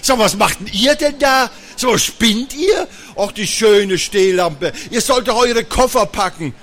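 loud, scolding mom voice; angry mother. Acted. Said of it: "Mama war sauer".

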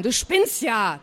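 plain reading voice

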